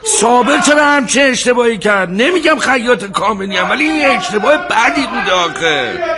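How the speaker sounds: high, exasperated outburst. Acted. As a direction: fret loudly, pitch held high.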